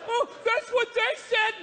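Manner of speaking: high, slow, shouted confusion; bewildered high yell. Acted. Redneck confused after outburst.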